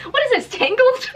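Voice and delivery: high, laughing jab; laughing mockery. Real, not acted. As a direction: giggle out the joke. Mocking comparison while laughing.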